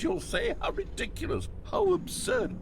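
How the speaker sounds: high squeaky mock disbelief. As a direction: pitch way up in fake outrage. Mock incredulous bucket joke.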